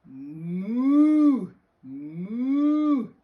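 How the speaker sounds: loud, long, swelling moos; cow moo. Acted. Dog George answering his mother, loud cow moos. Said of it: "And George went".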